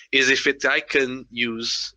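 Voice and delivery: usual speaking voice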